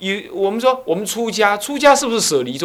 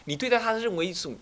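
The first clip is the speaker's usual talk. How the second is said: normal talking voice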